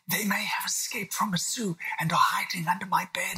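put-on a small boy fearing lions; worried little William. William warns dad from under the duvet, worried child voice.

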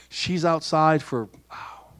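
ordinary talk, nothing marked

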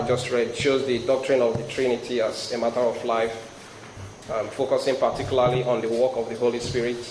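ordinary talk

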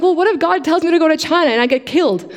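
high childlike fretting, mock fear; little girl telling mom her fear; scared little girl. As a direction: imitate a frightened kid, lightly done. Child self fears death in China.

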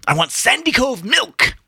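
high, slow, emphatic whine; petulant demand. A narrator's reading. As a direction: demand it like a sulky child. Petulant demand in acted quarrel.

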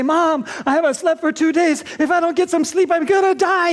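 exhausted mom begging her mother for help, frantic; desperate new mom. Retold or imitated. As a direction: beg your mother like you're falling apart. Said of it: "and you say".

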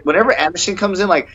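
ordinary talk, nothing marked

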